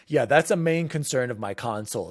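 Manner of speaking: usual speaking voice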